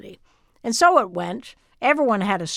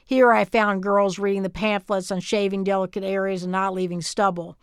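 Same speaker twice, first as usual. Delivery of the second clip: plain reading voice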